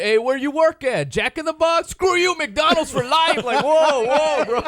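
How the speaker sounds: high, loud mock tough guy; tough California guy. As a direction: ham up a cocky street tough. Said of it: "California Latinos are always".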